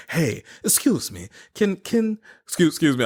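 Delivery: playing a French airport officer with a put-on French accent. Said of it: "If it's not a good French accent".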